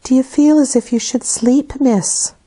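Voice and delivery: put-on a servant softly checking on a sick child; Bessie asking softly. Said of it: "asked Bessie, rather softly".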